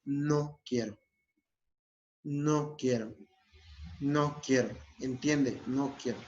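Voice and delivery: even, falling, identical repeats with pauses; broken-record refusal. Shown as a demonstration. Refuser repeating until the other understands, firm and even. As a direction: repeat it unmoved, never raising your voice. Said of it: "repetir el mensaje hasta que lo entienda".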